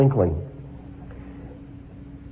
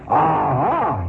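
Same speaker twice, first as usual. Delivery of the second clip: put-on a gruff roar from the drunk, only lightly raised; drunken roar; 2 semitones higher than usual. A narrator's reading. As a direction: bark it like a drunk spoiling for a fight. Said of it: "he roared".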